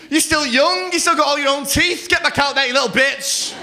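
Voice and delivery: acted exasperated shouty scolding; berating counsellor. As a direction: rant at them to get back out there.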